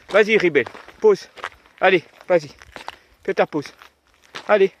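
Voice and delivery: loud, high coaxing command; talking to a dog. Real, not acted. Urging the dog to relieve itself.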